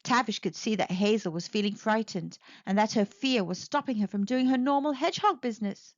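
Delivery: plain reading voice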